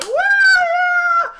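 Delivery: very high, loud "woo"; long shrieked cheer; celebrating whoop. Retold or imitated. Everyone cheering after the landing, shrieked whoop. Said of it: "everyone's just like".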